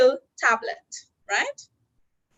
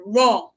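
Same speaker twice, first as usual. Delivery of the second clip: wrong said louder and clearly mouthed; clear teaching word; 6 dB louder than usual. Shown as a demonstration. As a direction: present the word wrong slowly for lip-readers. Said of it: "the first word I'm going to teach you is the word".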